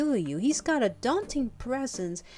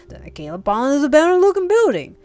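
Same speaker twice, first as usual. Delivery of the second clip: loud, raised, hearty storyteller; Gran the tiger; 3 semitones higher, 12 dB louder than usual. Acted. Tiger explains his find to the dragon, loud and animated. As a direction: belt out the tale in a big character voice.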